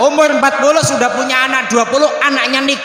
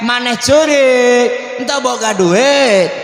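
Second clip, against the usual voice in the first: slow, lilting Sundanese questioning; drawn-out Sundanese elder; Sundanese speech; 3 semitones higher, much slower than usual. Acted. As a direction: stretch each phrase end in Sundanese singsong. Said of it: "Kalau Sunda ya".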